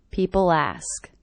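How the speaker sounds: "People ask" with the L carried straight into "ask"; linked L. Shown as a demonstration. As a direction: join "People ask" with no break. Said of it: "that L as really linking".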